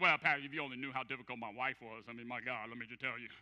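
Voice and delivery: low-pitched mock excuse; excuse-making. Person answering about a broken marriage, mock excuse-making. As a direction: act out a defensive excuse, pitched well down. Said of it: "their first response is an excuse".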